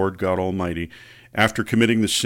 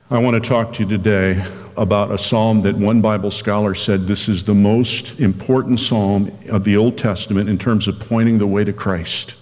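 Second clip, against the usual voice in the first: ordinary talk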